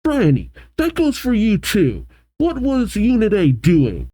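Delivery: high-pitched scolding, no real volume; mock shout. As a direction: act out an indignant shout at normal loudness. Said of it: "Mabel shouts".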